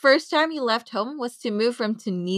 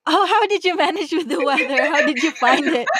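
high, lively question with a laugh; amused curiosity; 4 semitones higher than usual. Real, not acted. Host asking guest about Canadian weather, amused and higher-pitched. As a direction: ask eagerly, laughter breaking in.

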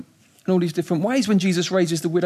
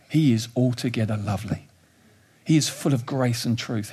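ordinary talk

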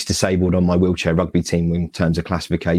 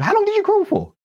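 much higher, amazed question; incredulous. Retold or imitated. Friend back home asks how long he crawled, much higher and amazed. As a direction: squeak the question in shock. Friends' astonished reaction, retold.

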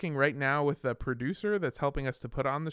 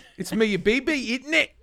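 high "isn't it" in a chirpy English lilt; mock British; 8 semitones higher, 4 dB louder than usual. Host adding a mock British tag question. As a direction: tease it like a chatty Londoner.

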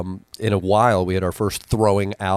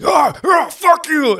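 much higher, louder mock shout; imitated scuffle yell; 6 dB louder, slower than usual. Drunk heckler thrown out by security, mock shouting. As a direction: yell like you're being dragged out. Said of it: "but it was like".

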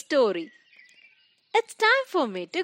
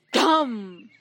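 imitated heavy thud; a big, booming "thum" spoken as a noise; loud thud. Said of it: "heard a loud sound".

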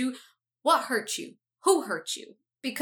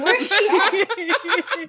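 drawn-out playful query; 6 semitones higher, much slower than usual. Retold or imitated. Imitating herself searching.

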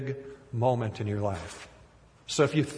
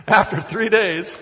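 breaking into laughter, louder; laughing; 6 semitones higher, 5 dB louder than usual. Real, not acted. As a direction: read while cracking up. Laughing at the place name.

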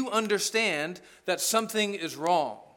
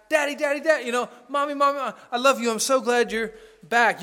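put-on higher, eager child calling out; light kid voice greeting parent; excited little kid; 5 semitones higher, 5 dB louder than usual. Small children greeting returning parent, higher and eager.

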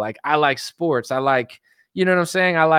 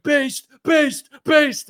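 much higher cheering chant; mock fans cheering; 9 semitones higher, much slower than usual. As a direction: cheer like a gullible crowd. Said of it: "everyone's like".

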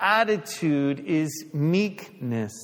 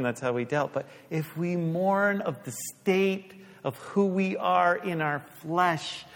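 ordinary delivery

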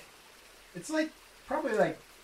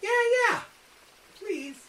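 eager pleading; 8 semitones higher, faster than usual. Acted. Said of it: "Stu's like".

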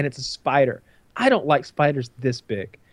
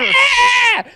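very high, loud hammed-up scream; mock death scream; 8 dB louder than usual. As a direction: scream as if dying, melodramatically. Plays Sam's death cry.

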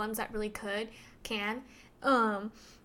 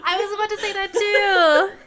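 higher and louder, talking through laughter; laughing; 9 semitones higher, 13 dB louder than usual. Real, not acted. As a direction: laugh your way through the line. Laughing while agreeing.

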